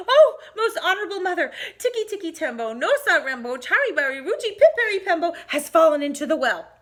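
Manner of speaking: put-on high, fast, excited little boy's cry; breathless urgent child. Chang running to tell his mother, urgent and high. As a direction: blurt it all out in a rush. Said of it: "Chang ran as fast".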